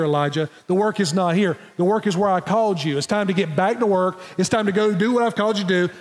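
ordinary delivery

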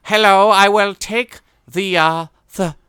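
high, slow, put-on posh accent; wrong accent. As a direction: stretch it out in a snooty put-on accent. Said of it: "I'm talking in the wrong accent".

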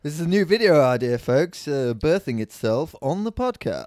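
loud, excited pitch to the listeners; excited announcement. Real, not acted. Host hyping a new video idea to listeners, loud and excited. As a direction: hype the new idea to the folks.

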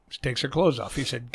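normal talking voice

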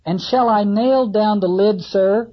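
carpenter asking. Acted. Carpenter asking Ahab about the lid, eager and loud. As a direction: ask the captain keenly, hammer in hand.

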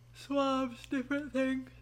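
talking through a real yawn. Real, not acted. Podcaster explaining a germ video to listeners, yawning through it. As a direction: let a yawn pull the words out long. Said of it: "I don't know why I'm yawning".

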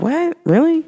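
high, incredulous "What? Really?"; mock disbelief. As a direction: act amazed at what they said.

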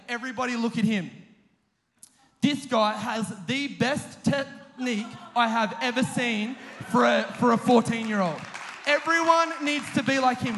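loud public praise, imitating a coach; emphatic mock-coach proclamation; proclaiming instructor. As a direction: announce it to the whole group as an impressed instructor. Acting instructor praising him to all.

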